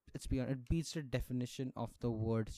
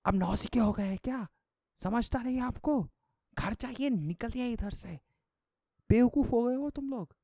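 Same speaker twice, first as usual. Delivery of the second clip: much higher, very fast, loud scolding rant in Hindi; Modi's voice; 11 semitones higher, 4 dB louder, much faster than usual. Retold or imitated. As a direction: rattle off a furious telling-off. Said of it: "with Modi's voice screaming at you".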